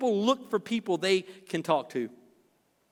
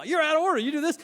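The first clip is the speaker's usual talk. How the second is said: a hint of a stern judge, higher; mock stern judge; 7 semitones higher, faster than usual. Counselor mocking a judge ordering the couple about, stern. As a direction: rule over the couple like a TV judge. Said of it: "I don't wanna be Judge Judy".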